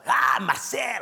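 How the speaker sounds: put-on high, loud French-accented cry of delight; hammed-up French delight; excited French exclamation. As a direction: exclaim the name like a thrilled Quebecois. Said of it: "I'm like".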